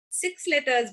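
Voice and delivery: ordinary talk, nothing marked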